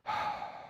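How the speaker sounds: deep audible breath out. Retold or imitated. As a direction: let out a long, deep breath. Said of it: "breathed deeply".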